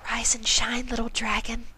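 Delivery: soft, high gentle pony voice; Fluttershy voice. Acted. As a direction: gently wake him.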